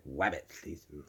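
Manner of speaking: low, hushed cartoon hunter saying "Wabbit" with w for r; "Wabbit" in a quiet, low cartoon speech impediment; cartoon lisp. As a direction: softly say "Wabbit", the r turned to w.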